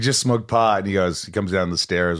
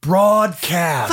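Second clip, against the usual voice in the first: teasing; 10 semitones higher, 5 dB louder than usual. Real, not acted. Mocking the podcast name pun.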